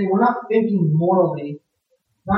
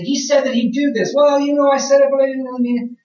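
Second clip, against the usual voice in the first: mock-defensive bickering; arguing vow-maker; 5 semitones higher than usual. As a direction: play a squabbling man wriggling out of a vow. Acted quarrel over vows.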